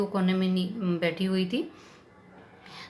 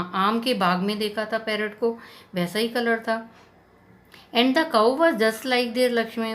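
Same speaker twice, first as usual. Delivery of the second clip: plain reading voice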